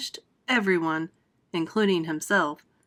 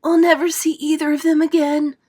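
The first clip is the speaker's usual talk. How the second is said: higher, louder wail of despair; despairing cry; 8 semitones higher, 8 dB louder than usual. Acted. Ladybird despairing for the lost boy, higher wailing cry. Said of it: "cried the ladybird".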